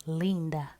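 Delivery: whispered Linda; Spanish pronunciation. A narrator's reading. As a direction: say Linda like a flower of a word. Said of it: "how his abuela pronounced".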